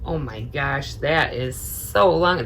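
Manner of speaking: ordinary delivery, nothing marked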